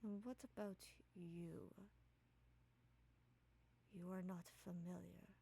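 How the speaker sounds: quietly imposing figure, pausing between phrases; soft, authoritative. Acted. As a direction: question them softly, with calm authority. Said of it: "in a very authoritative voice, but also soft".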